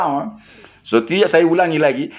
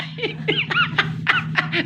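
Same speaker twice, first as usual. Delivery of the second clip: real laugh breaking out high; helpless high giggle; high burst of laughter; 5 dB quieter than usual. Real, not acted. Laughing at own story.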